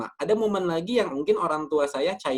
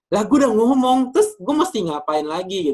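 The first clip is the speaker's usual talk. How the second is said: higher, louder, fed-up retort; frustrated child raising the voice at a parent who won't hear; mock exasperated yell; 5 semitones higher, 6 dB louder than usual. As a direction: snap back at the parent in a half-shout. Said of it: "Ketika kita berteriak".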